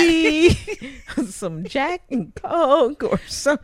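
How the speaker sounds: slow, laughing. Real, not acted. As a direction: chuckle through the list. Laughing listing drinks.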